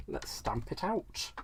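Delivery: slow and very soft; drawn-out, quiet self-talk; hushed murmur. Real, not acted. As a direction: murmur it softly while concentrating. Playful murmur while stamping.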